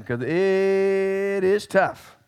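very slow, stretched warning; drawn-out emphasis. Real, not acted. Preacher warning listeners before a tough verse, drawn out.

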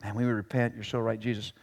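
sheepish imagined reply; imagined repentance. Pretend. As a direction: hush it like a sheepish apology. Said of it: "You'd really want a response".

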